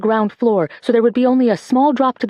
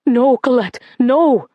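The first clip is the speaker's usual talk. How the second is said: louder, pleading no; frightened cry; 3 semitones higher, 3 dB louder, slower than usual. A narrator's reading. Mother warns daughter away, frightened louder cry. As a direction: warn her off in fear. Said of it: "her mother cried".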